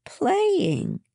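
high, drawn innocent reply. A narrator's reading. Eva answers her parents' question about what she was doing, innocently. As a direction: answer high, as if nothing happened. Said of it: "she said innocently".

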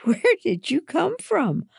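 very high, astonished question; startled gasp. A narrator's reading. Girl to the friend who leaped down beside her, gasping in surprise. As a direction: ask it high, caught by surprise. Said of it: "I gasped".

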